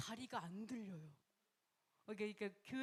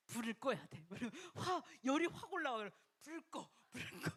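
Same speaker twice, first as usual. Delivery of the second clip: laughing; 3 semitones higher, 4 dB louder than usual. Real, not acted. Joking with the congregation about anger, laughing. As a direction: laugh through the joke.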